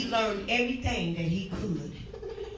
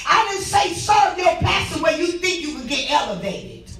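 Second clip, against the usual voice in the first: hollering revival preacher; 4 semitones higher, 6 dB louder than usual. Real, not acted. Hollering as God.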